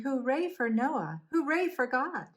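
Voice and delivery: high, jubilant hooray; storybook cheer. A narrator's reading. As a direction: cheer for Noah as a happy family. Noah's family cheers.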